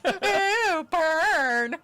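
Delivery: loud, high drawn-out jeer; stretched, high-pitched mocking cheer. Real, not acted. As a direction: rub it in with a big, loud whoop. Jeering reaction to a put-down.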